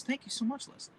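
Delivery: normal talking voice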